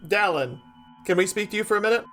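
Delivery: ordinary talk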